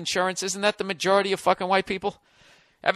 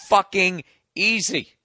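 drawn-out sarcasm; slower than usual. Acted. Slow sarcastic punch.

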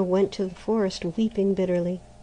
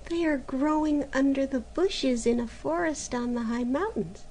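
put-on higher, gentle young girl's voice; meek girl; 6 semitones higher than usual. A narrator's reading. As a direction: Maruschka answering her sister shyly. Maruschka's gentle answer.

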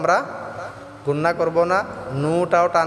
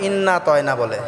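ordinary talk, nothing marked

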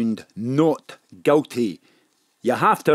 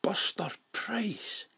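a breathless unvoiced whisper of disbelief; stunned whisper; 6 semitones higher, 15 dB quieter, slower than usual. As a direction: barely breathe the name, shocked. Gangster stunned by the photo.

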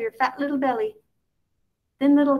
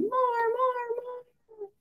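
put-on very high, fading tiny baby "more, more, more"; squeaky baby voice; 4 dB quieter, much slower than usual. A narrator's reading. Baby squeaking softly at grandma for more.

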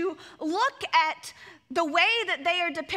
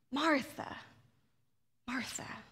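soft, lower, tender Martha, Martha; compassionate naming; 3 semitones lower, 8 dB quieter than usual. Shown as a demonstration. Jesus gently speaking to worried Martha, compassionate. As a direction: say it like a loving friend. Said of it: "a recognition of a relationship".

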